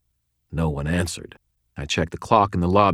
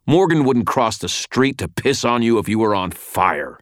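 louder, higher angry growl; 5 semitones higher, 4 dB louder than usual. A narrator's reading. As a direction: spit it out with contempt. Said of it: "Thomas growled".

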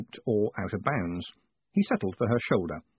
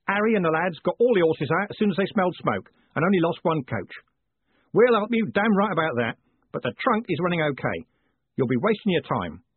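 a hint of a busy coachman, slightly raised; lightly voiced Jim; 6 semitones higher, 3 dB louder than usual. Coachman reporting to Moist on the fire, lightly in character. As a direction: lightly put on a helpful coachman.

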